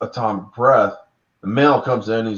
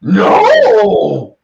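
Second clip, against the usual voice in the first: much louder, high, wailing no; anguished monster scream; 10 semitones higher, 10 dB louder than usual. Retold or imitated. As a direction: cry out in shock and loss.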